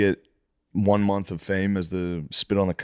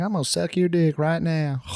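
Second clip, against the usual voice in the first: put-on lilting southern accent, slightly raised; 5 semitones higher than usual. Man imitates the lady on the viral clip, southern accent. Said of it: "With that southern accent".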